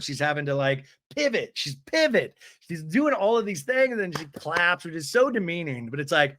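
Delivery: usual speaking voice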